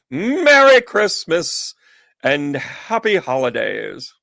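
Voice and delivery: high, loud, slow jolly Santa. Shown as a demonstration. Macy's North Pole greeting in jolly Santa voice. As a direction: boom out a merry ho ho ho. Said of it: "the voice of the North Pole".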